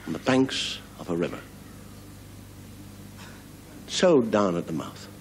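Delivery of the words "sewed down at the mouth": ordinary talk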